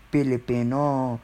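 "Filipino" with both F sounds turned to P. Shown as a demonstration. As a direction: say "Filipino" as "Pilipino". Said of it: "put your F to be peace".

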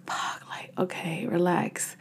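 low, quiet mutter to herself; hushed self-talk. Retold or imitated. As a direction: retell talking yourself down under your breath. Telling herself to calm down.

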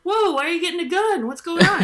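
high, fast, alarmed yelp; acting out the startled other side, high and quick; mock alarm. The other side asks why you got a gun, mock alarmed and high.

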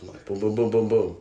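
normal talking voice

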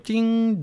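ping it out high like a microwave finishing; microwave ding. Retold or imitated. Dad mimicking the microwave telling him the meat is done, high chime. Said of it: "like a microwave".

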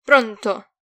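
loud, belligerent hello. A narrator's reading. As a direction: snap it like you're ready for a fight. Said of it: "sbottò bellicoso".

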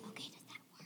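soft whisper into mic. Real, not acted. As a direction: whisper it to test. Mic test.

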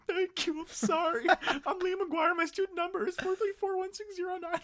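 very high sobbing voice; crying thanks. Retold or imitated. Upset student thanking office lady, very high sobbing voice. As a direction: sob out the thanks and number, very high.

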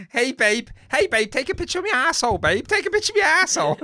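very high, loud comic put-on voice; hammed-up caller to babe. Calling babe over to take a picture, high hammed-up comic voice. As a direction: call out high and insistently, playing it for laughs. Joins in the silly accent impressions.

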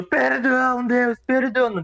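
high strained yelling plea; yelling hard. Retold or imitated. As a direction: yell for forgiveness, voice tearing. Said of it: "Tipo, arregaçando a voz". (gritando com a voz rasgada)

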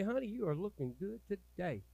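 soft, sweet compliment; wife sweet-talking her husband, soft; sweet nothings. Acted. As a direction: coo it softly to your husband. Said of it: "whisper sweet nothings".

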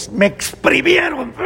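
a high, far-off shout of pain as the sugarcane; squeezed cane crying out. Acted. La caña se queja gritando. (la caña gritando que la exprimieron)

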